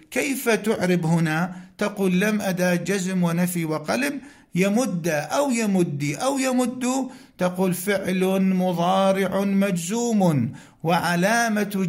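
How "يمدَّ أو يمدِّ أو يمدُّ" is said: "يمد" as yamudda, then yamuddi, then yamuddu; three vowel endings. Shown as a demonstration. Teacher parsing the verb, "يمد" with fatha, kasra, then damma. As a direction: end each "يمد" differently: a, i, u. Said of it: "إذن يجوز الفتح".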